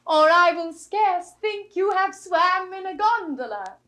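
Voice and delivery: loud, teasing mockery; mock him broadly and loudly. Rosalind mocking Jaques loudly.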